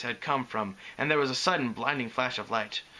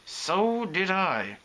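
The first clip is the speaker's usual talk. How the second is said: slow, higher, dry retort; 6 semitones higher, much slower than usual. A narrator's reading. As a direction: draw it out drily, unsympathetic. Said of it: "she repeated dryly".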